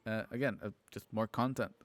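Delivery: ordinary talk, nothing marked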